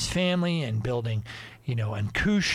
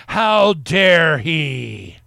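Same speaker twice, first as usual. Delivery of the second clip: mock outrage; 2 semitones higher, 9 dB louder, much slower than usual. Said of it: "pretends like he's angry".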